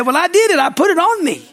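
high-pitched mock protest; acted protest. Acting out a boastful claim.

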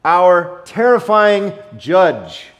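slow, loud, looming delivery; mock-grand dramatic wrong version; slow, weighty mock misquote. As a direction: draw out the wrong title with heavy weight.

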